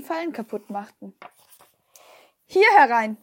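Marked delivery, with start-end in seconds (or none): 2.4-3.2 loud order from another room; commanding. A narrator's reading. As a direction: command someone to come in. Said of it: "kommandierte Herr Tetzels Stimme".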